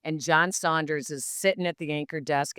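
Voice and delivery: ordinary talk, nothing marked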